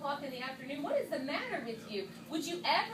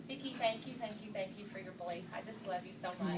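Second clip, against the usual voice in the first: softer and lower, tender; heartfelt thanks; 7 semitones lower, 8 dB quieter than usual. Real, not acted. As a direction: thank her close to tears. Thanking her mentor with emotion.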